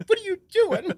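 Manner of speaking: very high, loud mock-outraged squeal; hammed-up indignant scolding; scolding wife. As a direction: imitate an exasperated wife telling you off. Wife slaps and scolds him.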